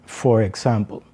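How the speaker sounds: normal talking voice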